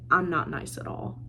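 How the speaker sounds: low, soft imitation of a brooding man; brooding captain. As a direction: drop low and quiet for the captain. Brooding Captain's ominous admission.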